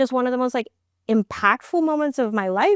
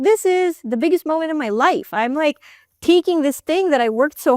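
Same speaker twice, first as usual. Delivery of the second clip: high, eager rush of words; excited; 9 semitones higher than usual. Retold or imitated. Wife telling husband her big moment, high and excited.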